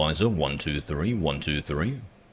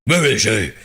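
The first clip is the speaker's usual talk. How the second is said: stupid-sounding gruff superhero, higher pitch; 5 semitones higher, 4 dB louder than usual. Retold or imitated. Streamer repeating the Batman line to mock it, hammed-up growl. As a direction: overdo the terrible growly interrogation. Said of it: "It sounds so stupid".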